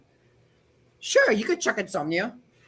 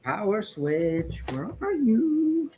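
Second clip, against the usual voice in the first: strained, fading voice; losing voice; 6 dB quieter, slower than usual. Real, not acted. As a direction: voice going hoarse. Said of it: "I'm losing my voice slowly".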